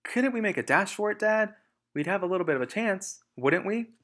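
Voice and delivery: high, eager little voice begging Dad; pleading child fox. Acted. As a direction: plead to make a dash for it. Small fox pleading with father.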